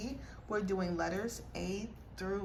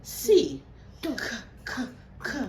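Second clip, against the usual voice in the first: loud, slow letter sounds; 4 semitones higher, 7 dB louder, much slower than usual. Real, not acted.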